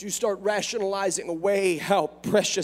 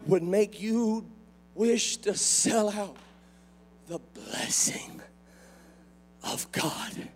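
hushed preaching; 4 semitones lower, 4 dB quieter, slower than usual. Acted.

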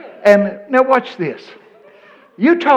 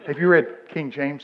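normal talking voice

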